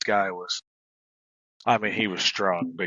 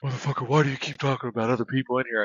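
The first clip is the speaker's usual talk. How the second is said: fast, agitated mock rant; lightly voiced strung-out guy, exasperated; hammed-up confused outburst; irritated high-strung suspect; 3 semitones higher, faster than usual. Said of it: "He's like".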